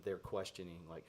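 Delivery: usual speaking voice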